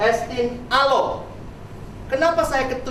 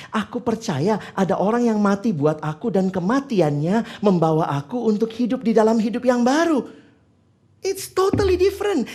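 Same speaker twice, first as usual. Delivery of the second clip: usual speaking voice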